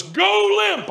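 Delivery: high, drawn-out urgent call; acted alarmed shout; panicked trainer. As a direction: call an emergency instruction across a set. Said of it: "who looks panicked".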